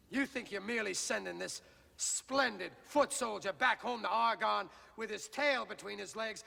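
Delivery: usual speaking voice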